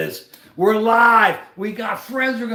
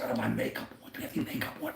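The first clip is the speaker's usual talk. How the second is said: mock whisper; 8 semitones lower, 11 dB quieter, much faster than usual. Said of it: "they're all out there".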